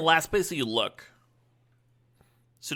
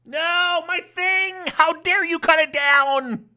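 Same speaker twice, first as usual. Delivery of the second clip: high, loud, slow theatrical cry; mock outrage; 14 semitones higher, 7 dB louder, slower than usual. Streamer wailing in mock outrage as the opponent destroys his card. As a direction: ham up the betrayal.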